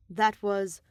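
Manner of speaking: usual speaking voice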